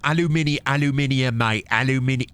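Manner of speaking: put-on British accent, insistent repeats; mock British. Gamer teasing British speech, mock British accent. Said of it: "I make fun of the British".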